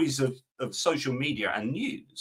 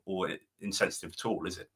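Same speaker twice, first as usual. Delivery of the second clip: ordinary talk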